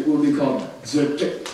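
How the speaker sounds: ordinary talk, nothing marked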